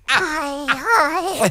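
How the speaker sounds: put-on high-pitched little baby voice. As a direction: squeak it out like a baby. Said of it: "Do the baby voice".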